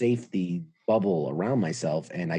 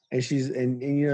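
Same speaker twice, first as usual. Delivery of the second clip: ordinary talk, nothing marked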